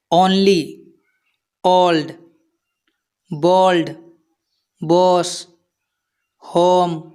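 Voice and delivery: put-on only, old, bold, boss, home each with an "oh" vowel. Shown as a demonstration. Teacher giving learners examples, "oh" sound in only, old, bold, boss, home. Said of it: "O की तरह से किया जाता है".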